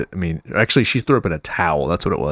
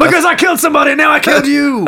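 mock shout; 7 dB louder, faster than usual. Joking mock confession shouted.

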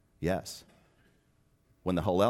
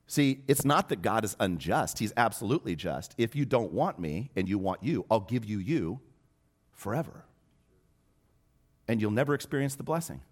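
higher, urgent preacher's push; fervent preaching; 5 semitones higher, 4 dB louder than usual. Acted. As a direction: drive the point home to the congregation. Preaching at full force.

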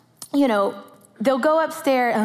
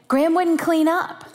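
put-on tattling kid; 7 semitones higher than usual. Older boy tattling.

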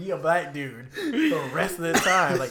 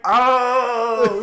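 high, loud drawn-out "oh" of recognition; big retold gasp of delight; crowd's excited realisation; 7 semitones higher, 9 dB louder than usual. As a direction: react like a whole audience suddenly getting it. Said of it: "everyone was like".

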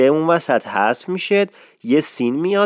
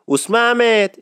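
respectful call; 8 semitones higher, slower than usual. Shown as a demonstration.

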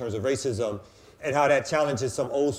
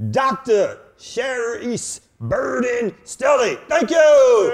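Cookie Monster voice; 8 semitones higher, 9 dB louder, much slower than usual. Acted. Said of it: "put the Cookie Monster voice on".

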